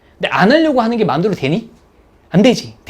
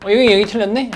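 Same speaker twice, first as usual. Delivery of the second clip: confident top student; 3 semitones higher than usual. Retold or imitated. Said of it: "풀 때 이렇게 풀어".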